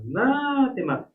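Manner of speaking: "anátema" with NÁ high and held long; slow, high a-NÁÁÁ-tema; stressed-syllable demo. Shown as a demonstration. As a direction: stretch the second syllable of "anátema". Teacher demonstrating the stressed syllable.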